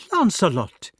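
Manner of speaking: calls his name out high and loud; a sharp, outraged cry of his name; shocked outcry. A narrator's reading. Queen shocked by his insult.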